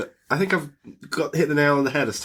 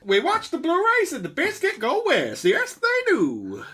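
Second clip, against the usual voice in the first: cowboy twang; 12 semitones higher than usual. Acted. Continues the Western intro voice.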